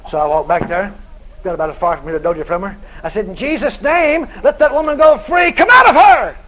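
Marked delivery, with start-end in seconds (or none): 3.2-6.4 high, loud rebuke of the devil; preacher's exorcism command; commanding shout. Retold or imitated. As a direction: order the demon out at full force. Retold command to cast out devil.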